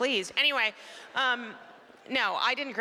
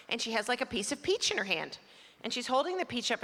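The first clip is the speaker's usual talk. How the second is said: usual speaking voice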